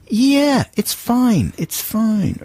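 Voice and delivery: high, brushing-off reassurance; dismissive director. Retold or imitated. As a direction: wave the worry away in a high voice. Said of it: "They just say".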